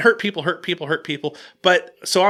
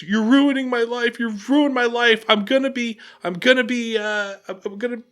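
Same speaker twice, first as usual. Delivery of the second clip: higher-pitched mock wail, fading out; imitated sobbing; 6 semitones higher than usual. Employee breaking down on camera, imitated sobbing. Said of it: "Starts sobbing and goes".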